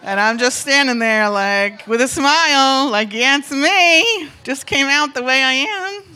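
loud, drawn-out comic awkwardness; awkward self-presentation. Retold or imitated. Woman standing under a stranger's stare, awkwardly presenting herself. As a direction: stretch it out through a stiff smile.

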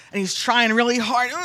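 usual speaking voice

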